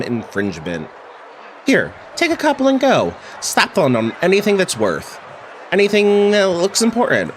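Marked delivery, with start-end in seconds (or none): 1.7-7.3 character voice. Acted.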